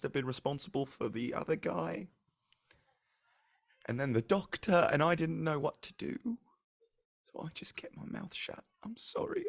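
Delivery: put-on a scared girl owning up, apology rising high; distressed young Darla. Darla confesses in her voice.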